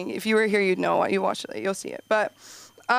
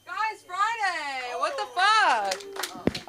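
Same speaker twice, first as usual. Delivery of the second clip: excited entrance; 7 semitones higher, much slower than usual. Real, not acted. New comic bursting onstage.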